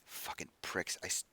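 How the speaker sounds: soft muttered cursing; sulky grumbling. Acted. Silenced hothead backing off, muttering sulkily. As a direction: grumble under your breath in broken bits.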